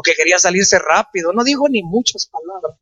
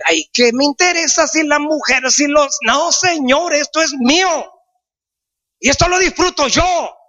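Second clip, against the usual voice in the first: joyful excitement; 8 semitones higher than usual. Real, not acted. Joyful personal praise, excited.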